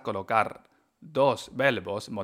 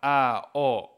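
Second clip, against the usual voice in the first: usual speaking voice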